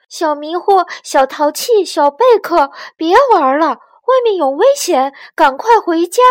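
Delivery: high, loud urgent calls; calling out. A narrator's reading. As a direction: call the little ones home. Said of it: "帮着呼喊".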